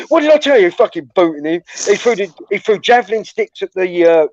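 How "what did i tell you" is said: high, loud angry retold yell; furious schoolboy yell, retold. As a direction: bark it while kicking him. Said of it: "he was going".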